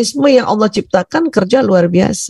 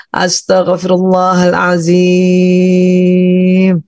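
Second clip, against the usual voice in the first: very slow chant, last syllable held; full istighfar; 3 semitones lower, much slower than usual. Shown as a demonstration. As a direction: stretch it out on one steady note. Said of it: "coba istighfar ya".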